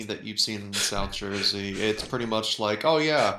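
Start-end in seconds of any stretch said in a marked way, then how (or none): 2.9-3.4 louder, higher put-on quote; mock explanation. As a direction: throw it out loudly as a sarcastic quote. Acting out South Jersey's explanation.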